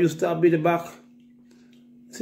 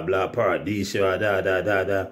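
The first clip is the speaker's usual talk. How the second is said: ordinary talk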